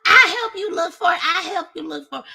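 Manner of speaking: high fake-helpful voice; friend's eager voice. Thieving friend pretending to help, high and loud. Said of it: "She's like".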